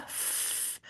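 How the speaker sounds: f, only air; breathy f with no voice; voiceless f. Shown as a demonstration. Teacher showing voiceless f. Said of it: "So if I have F".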